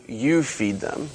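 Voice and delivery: slow, soft, measured answer; calm reply. Acted. Jesus answers his panicking disciples, calm and soft. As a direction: drop to a quiet, unhurried calm.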